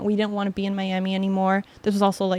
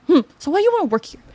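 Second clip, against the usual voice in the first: brisk chef question; 8 semitones higher, 5 dB louder, much faster than usual. Retold or imitated. Chef interviews her.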